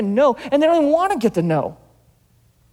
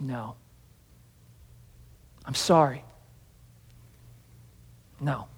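a much softer, gentle no; soft refusal; 10 semitones lower, 12 dB quieter, slower than usual. Retold or imitated. Jesus quietly refusing the begging man, soft. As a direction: let him down softly. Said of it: "And Jesus goes".

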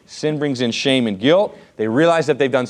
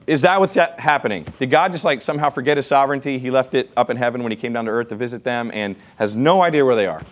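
ordinary talk

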